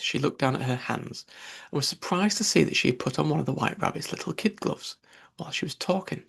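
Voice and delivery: plain reading voice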